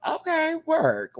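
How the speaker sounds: high, drawn-out polite approval; slow, high-pitched lukewarm cheer; not-quite-wowed approval. Retold or imitated. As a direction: stretch it out high, mildly impressed. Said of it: "I wasn't wowed by it".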